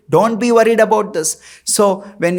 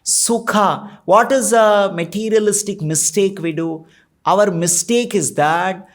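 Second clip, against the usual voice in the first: ordinary delivery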